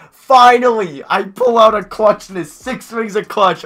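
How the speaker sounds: high, loud slow gloating cheer; triumphant boast. Real, not acted. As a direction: savour the win loudly. Celebrating winning the finals.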